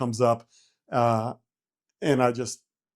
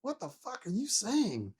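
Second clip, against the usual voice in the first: slow, higher, softer retold bafflement; baffled; 7 semitones higher, 6 dB quieter, slower than usual. Dazed pilot to the other man, baffled. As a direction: stretch the question out, uncomprehending. Retold failure to understand speech.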